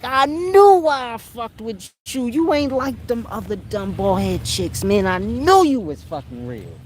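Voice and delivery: put-on rejoice like a man saved by his girl; overjoyed boyfriend. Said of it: "you be like".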